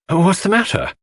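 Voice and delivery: jolted awake, asking what's wrong; startled waking. A narrator's reading. Narrator woken by Lawrence, startled.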